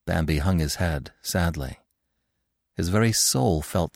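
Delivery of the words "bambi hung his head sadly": plain reading voice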